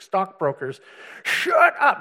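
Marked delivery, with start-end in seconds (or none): 1.2-2.0 much higher, louder shout; angry yell. Retold or imitated. Stockbrokers yelling at the preacher, loud shout. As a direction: shove it right in his face. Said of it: "guys come up to me in thousand dollar suits".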